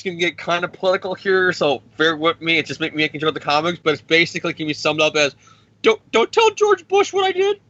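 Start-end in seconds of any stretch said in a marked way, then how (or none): 5.8-7.6 very high, squeaky, pleading voice; Tony begging Dick Cheney on a call, squeaky and high; whiny Tony Stark. Retold or imitated. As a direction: whimper it like a nervous kid. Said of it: "he's on a call with Dick Cheney".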